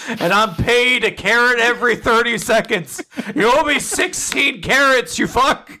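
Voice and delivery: high, mock-outraged horse; indignant horse. Horse owed carrots by its rider, mock indignant.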